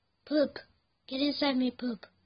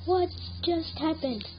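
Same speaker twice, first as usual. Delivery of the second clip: stunned disbelief; 4 semitones higher than usual. Real, not acted. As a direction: reel from a sudden loss, repeating it. Stunned by losing the game.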